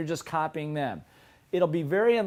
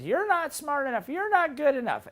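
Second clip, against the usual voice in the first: high-pitched belittling inner critic; nagging little voice; 10 semitones higher than usual. Acted. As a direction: needle them in a high little voice. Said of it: "the little voice says".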